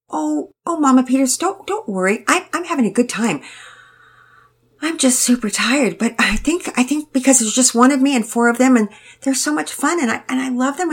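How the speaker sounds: put-on high, eager-to-please girl rushing on; tired young girl. A narrator's reading. Penelope explains herself to Mama Peters, high and earnest. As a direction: plead earnestly, a bit flustered.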